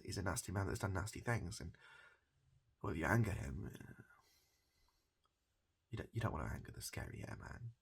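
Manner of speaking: normal talking voice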